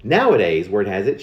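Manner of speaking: ordinary delivery, nothing marked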